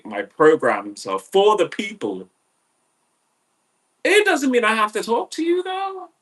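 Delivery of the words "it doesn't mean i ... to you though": high fast mocking voice.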